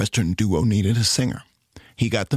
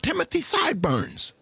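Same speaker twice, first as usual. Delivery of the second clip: excited near-shout; 10 semitones higher than usual. A narrator's reading. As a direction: brighten up and almost shout it. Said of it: "brightened up and nearly shouted".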